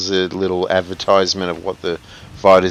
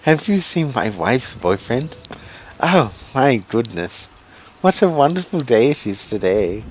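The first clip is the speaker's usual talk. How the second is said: high sing-song elf; 5 semitones higher than usual. Retold or imitated. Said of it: "Oh, the elf voices".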